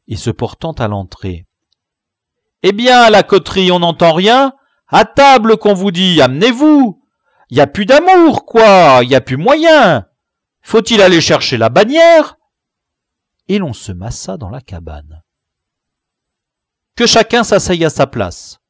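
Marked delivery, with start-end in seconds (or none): 2.6-12.4 much higher and louder, hollering; shouting call. Acted. Le Braque hollers at the stragglers to come eat. 16.9-18.6 higher and louder command; ordering. Acted. The chief orders each boy to his seat, loudly. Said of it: "ordonna le chef".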